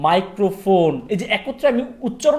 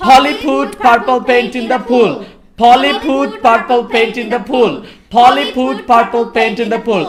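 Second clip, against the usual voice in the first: each word slow and loud, Polly with a breathy f; teacher demonstrates the drill word by word; slow tongue twister; 4 semitones higher, 7 dB louder, much slower than usual. Shown as a demonstration. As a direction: spell out the tongue twister slowly and loudly. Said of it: "tongue twister".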